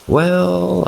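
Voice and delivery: put-on a high, playful childlike "Well"; lightly voiced eerie girlfriend, coaxing; sing-song eerie girlfriend opening. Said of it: "with a huge, playful grin".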